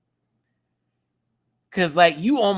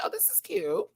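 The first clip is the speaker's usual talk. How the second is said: high cooing approval; 5 dB quieter than usual. Retold or imitated. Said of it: "I was like".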